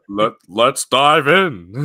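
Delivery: slow, loud drawn-out hype; drawn-out YouTuber intro. Retold or imitated. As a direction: stretch it like a remix. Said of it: "and he goes".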